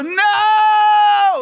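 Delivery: high, loud yelled refusal; defiant shout. Pretend. Person refusing God's request, hammed-up defiant shout. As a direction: scream no like a stubborn child. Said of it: "And you just go".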